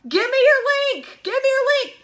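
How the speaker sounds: excited prospect. Acted. Prospect suddenly begging for the link, excited. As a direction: demand it, bursting with mock eagerness. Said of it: "they are so excited".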